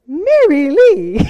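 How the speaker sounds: put-on drawn-out Scottish accent on her name; Scottish Gordon. Gordon greeting Mary Lee on the phone in a slow Scottish accent.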